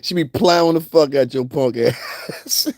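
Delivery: normal talking voice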